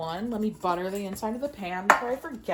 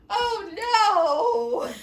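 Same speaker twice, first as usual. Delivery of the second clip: high, loud panicked oh no; dismayed cry; 12 semitones higher, 7 dB louder than usual. Real, not acted. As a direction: wail it in sudden dismay. Realizes she threw out boxes.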